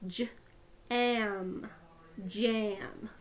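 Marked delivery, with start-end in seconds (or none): 0.1-1.7 each sound said separately with a short break; sounds tapped apart. Shown as a demonstration. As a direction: split the word into its sounds, one by one. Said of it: "let's tap it out".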